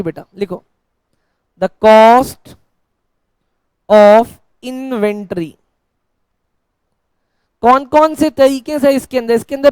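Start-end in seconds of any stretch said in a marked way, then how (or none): 1.6-5.5 word by word, with long pauses; slow dictation. Real, not acted. Teacher dictates the heading to students, slow dictation. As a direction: dictate slowly for note-takers, leaning on "cost".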